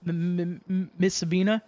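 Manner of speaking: nervous suitor tripping over her name; shy man stuttering as he asks her out. Retold or imitated. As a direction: catch on the M of Miss several times.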